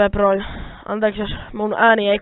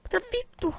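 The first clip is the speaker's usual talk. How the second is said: squeaky, much higher yelp of disbelief, strange voice; shocked outburst; 9 dB quieter than usual. Real, not acted. Hitting it with a stone sword, high squeaky shock. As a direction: squeal it, baffled the sword didn't work.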